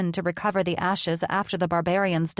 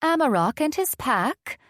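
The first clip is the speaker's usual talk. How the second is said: high, lilting mock taunt; sing-song taunt; 8 semitones higher than usual. A narrator's reading. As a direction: sing it out to tease them. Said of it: "she taunted in a sing-song voice".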